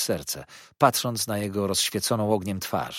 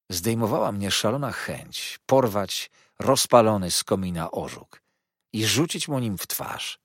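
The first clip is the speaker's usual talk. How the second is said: plain reading voice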